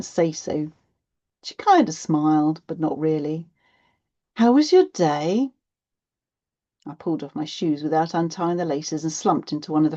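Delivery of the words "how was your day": higher, louder greeting question; chirpy mum asking her child; mum asking after the day. A narrator's reading.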